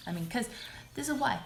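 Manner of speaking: usual speaking voice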